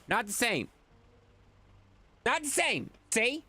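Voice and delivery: high-pitched, exasperated protest; strained high indignation, drawing out the explanation; angry voice. Acted. Streamer insisting to chat he doesn't blend into his green screen, exasperated. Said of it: "I like your angry voice".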